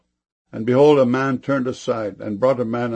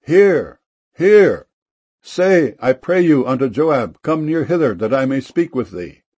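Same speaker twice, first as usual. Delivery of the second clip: high, loud call, easing toward the end; raised, carrying narrated cry; 4 semitones higher, 6 dB louder than usual. A narrator's reading. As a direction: call out for attention across a distance. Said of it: "then cried a wise woman out of the city".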